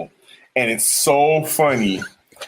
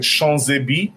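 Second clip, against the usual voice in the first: put-on very French accent. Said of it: "She says it in a very French accent".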